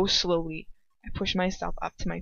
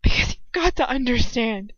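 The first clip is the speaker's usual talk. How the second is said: ordinary delivery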